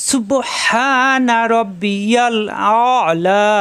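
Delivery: drawn-out tajweed recitation; chanted model reading; slow correct recitation. Shown as a demonstration. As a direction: stretch each sound slowly. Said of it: "পড়তে হবে".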